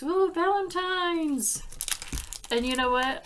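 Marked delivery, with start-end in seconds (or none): 0.0-1.6 delighted. Real, not acted. Delighted at new stamps.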